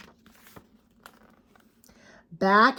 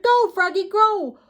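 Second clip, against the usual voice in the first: high cheering call; cheering shout; 10 semitones higher, 5 dB louder than usual. Acted. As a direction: cheer him on from the side. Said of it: "Froggy's friend shouted".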